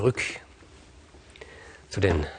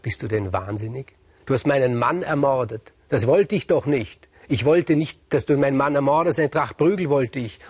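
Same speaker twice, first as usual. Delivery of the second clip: outraged queen; 6 semitones higher, 4 dB louder than usual. Acted. Queen horrified at murder.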